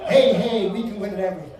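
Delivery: mock cheer chant, loud at the start; preteen cheerleaders. Preteen cheerleaders chanting all day, lightly mocked. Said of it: "National Preteen Cheerleading Convention".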